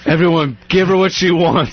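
frantic hostage voice. Pretend. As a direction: urge everyone to give in. Mock hostage standoff.

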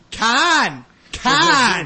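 loud, high Con with no r; shouted con for corn. Retold or imitated. Mother in supermarket drilling kids: shouted Con. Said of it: "telling her children how to say corn, and she's going".

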